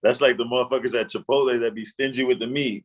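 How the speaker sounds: ordinary talk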